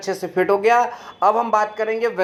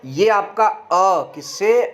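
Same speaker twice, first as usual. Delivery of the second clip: slow and deliberate, each word spaced; slow explaining; slower than usual. Shown as a demonstration. Teacher slowly breaking down ए into parts. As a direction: spell it out slowly for students. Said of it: "तो समझिए".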